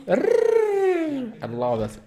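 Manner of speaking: high, slow, drawn-out squeal of delight; a long high gushing squeal. Real, not acted. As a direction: gush over her, way up high. Gushing over contestant.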